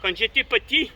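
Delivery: normal talking voice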